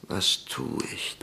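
barely voiced, whispered question; unnaturally soft whisper; unnaturally quiet. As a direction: speak into the silence almost without voice. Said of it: "Unnatürlich leise".

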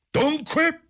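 very high triumphant madman. A narrator's reading. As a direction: pounce on it in triumph, pitch shooting up. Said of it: "disse il pazzo con aria trionfante".

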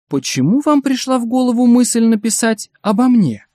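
high-pitched, mildly stern question; stern but softening. A narrator's reading. Flattered director questioning his would-be biographer, stern but melting. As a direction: demand a reason while secretly touched. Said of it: "Директор произнёс это строго, но было заметно, что он уже растрогался".

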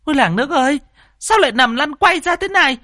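very high, frantic village busybody; high-pitched, fussing clamour; squawking outcry. A narrator's reading. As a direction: holler in alarm to rouse the neighbours. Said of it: "mụ chu chéo".

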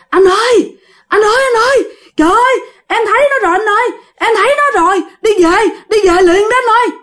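high, fast panicked cries; terrified crying out. Retold or imitated. Friend screaming to dad after seeing the ghost, panicked. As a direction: plead in terror, voice cracking high.

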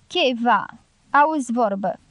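ordinary delivery, nothing marked

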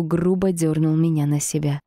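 ordinary delivery

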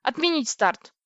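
loud, high-pitched angry command; sharp captain's shout; barked order. A narrator's reading. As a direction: bark the countermand. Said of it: "рявкнул Владлин".

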